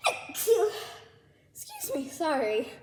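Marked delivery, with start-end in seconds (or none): 0.1-0.7 loud real sneeze; sharp sudden achoo; sneezing. Real, not acted. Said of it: "the smell is making me sneeze".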